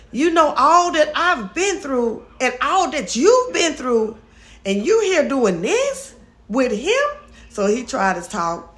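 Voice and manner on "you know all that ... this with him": higher, pleading retelling of Amber; Amber retold. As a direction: reproach Mama Nat in a raised voice. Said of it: "She said".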